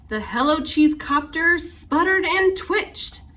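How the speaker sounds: ordinary delivery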